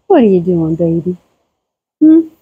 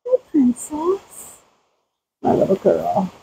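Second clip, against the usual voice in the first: soft cooing; 7 dB quieter than usual. Real, not acted. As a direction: coo gently at her. Greeting the goats.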